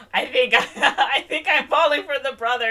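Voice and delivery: high, loud retold confession through laughter; laughing mock confession. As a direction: blurt it out, giggling at the drama. Acted confession while laughing.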